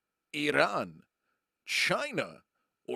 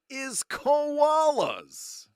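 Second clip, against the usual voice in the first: dramatic reveal; 12 semitones higher, 7 dB louder, much slower than usual. Acted. Quiz host revealing answer dramatically.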